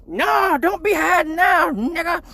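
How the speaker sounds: high scolding mom; high hammed-up mom scolding; angry mom voice. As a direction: scold like his mom kicking the door in.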